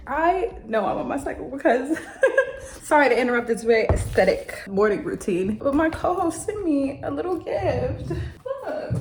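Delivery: high, teary voice through laughter; laughing through happy tears; tearful laughing. Real, not acted. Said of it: "I'm literally crying".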